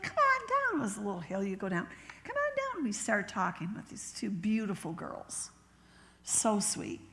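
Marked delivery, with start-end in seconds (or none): 0.0-0.8 high, sing-out beckoning call; imitated calling out. Girls calling her down to the yard sale, imitated high call. 2.2-2.8 very high beckoning call, falling at the end; imitated calling out. Girls inviting her down the little hill, imitated high call. Same call repeated after aside.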